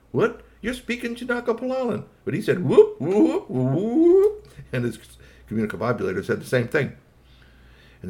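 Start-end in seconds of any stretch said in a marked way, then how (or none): 0.1-2.0 much higher, squeaky surprise; astonished. Acted. Iggy Pop startled the kid speaks his language, very high. 2.6-4.5 very high, loud whooping call; alien whoops. Acted. Kid answering in alien tongue, very high loud whoops.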